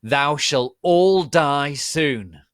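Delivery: very slow, loud villain threat; hammy villain. Acted. Voldemort threatening everyone below, slow booming threat. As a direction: drag out the doom grandly.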